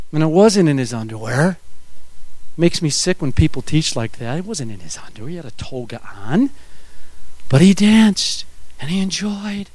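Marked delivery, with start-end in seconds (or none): 4.4-6.5 much softer, lower dry aside; low, hushed muttered correction; quiet aside. Real, not acted. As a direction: drop the voice to correct the record. Dry aside after indignant remark.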